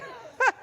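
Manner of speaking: quick amused cackle; sudden high laugh. Real, not acted. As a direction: burst of high-pitched laughter. Laughs at clerk's doubt.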